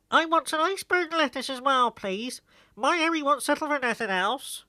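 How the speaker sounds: a fussy countrywoman ordering at the shop; village woman. A narrator's reading. Ivy Crook asks the postmistress for lettuce, high rustic woman's voice.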